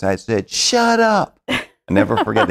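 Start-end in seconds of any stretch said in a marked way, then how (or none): 0.5-1.4 mock yell, pitched very high; retold yell. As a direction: re-enact an exasperated top-of-the-voice yell. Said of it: "I yelled, top of my voice".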